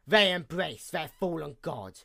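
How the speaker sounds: normal talking voice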